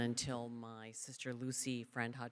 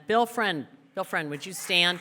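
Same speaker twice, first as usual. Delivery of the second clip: calling out; 4 semitones higher, 6 dB louder than usual. Real, not acted. Calling brother out to stand.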